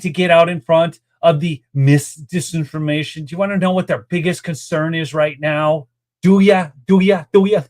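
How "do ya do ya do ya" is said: teasing build-up. Pretend. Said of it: "I gotta build it up".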